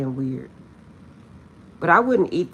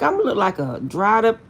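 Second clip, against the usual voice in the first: laughing; 5 semitones higher, 6 dB louder than usual. Retold or imitated. Laughing quote.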